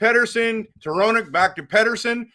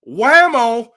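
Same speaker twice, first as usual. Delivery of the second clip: loud burst, pitched up; excited whammo; 5 semitones higher, 8 dB louder than usual. Real, not acted. Hockey fan seeing his player's shot score, loud excited cry. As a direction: shout it as the puck rips in.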